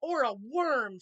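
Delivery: teasing threat. A narrator's reading. Star taunting the elf.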